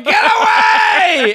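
high, loud, slow drawn-out mock yell; imitating a frantic shout. Teasing a friend about her street panic, hammed-up frantic yell. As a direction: ham up a crazed warning to the street. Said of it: "I'm just imagining you going".

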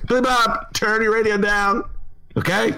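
high, sharp host scolding a caller; fed-up host barking at a caller; annoyed radio host. Retold or imitated. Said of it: "the radio host would be so".

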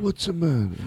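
Godfather voice. Acted. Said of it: "Godfather impersonations".

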